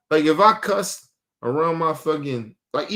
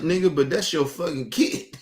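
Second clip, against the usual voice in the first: ordinary talk, nothing marked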